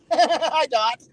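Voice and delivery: cheeky laughing hello; teasing the DOT while laughing; laughing greeting. Real, not acted.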